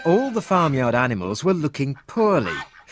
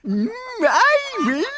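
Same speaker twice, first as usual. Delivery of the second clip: high, sing-song cow voice, dreamy; a curious cow musing to herself; lilting high cow voice; Blue Cow. Acted. Blue Cow offers help.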